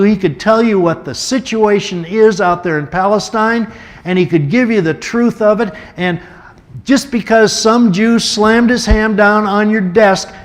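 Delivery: impassioned plea. Retold or imitated. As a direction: beg an old friend to listen. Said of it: "I put my hand on it".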